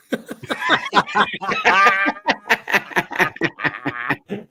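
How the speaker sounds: high, sustained laughter; long laughing fit. Real, not acted. Cracking up at the left-handed punchline, long high laugh. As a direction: keep laughing helplessly at length.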